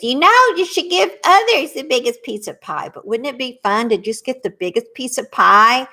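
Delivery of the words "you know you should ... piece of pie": higher, fast, lightly voiced little boy; a hint of a scolding little boy. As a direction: chirp it like a small child. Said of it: "When the little boy says".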